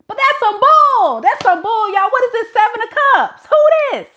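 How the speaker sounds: loud, high-pitched burst of indignation; outraged shout. Real, not acted. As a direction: explode in disbelief, calling out nonsense. Outburst after the mock apology.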